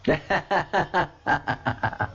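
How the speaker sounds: a high, soft laugh that falls away; a real laugh that trails off. Real, not acted. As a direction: laugh at your own joke. Laughs at own joke.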